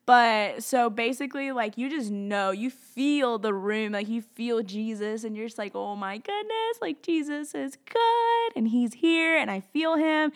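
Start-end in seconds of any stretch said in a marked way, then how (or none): 5.7-10.3 high-pitched gushing wonder; awed excitement. Retold or imitated. Worshipper feeling Jesus in the room, overjoyed. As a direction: start very high and squealing, draw out the praise.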